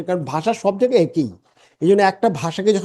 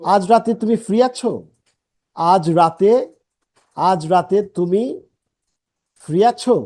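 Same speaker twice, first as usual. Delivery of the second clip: slow, with a pause after রাতে; আজ রাতে said slowly, pause, then free আছো; pauses in the phrase; slower than usual. Shown as a demonstration. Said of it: "বাংলাও কিন্তু দেখেন এখানে পজ আছে".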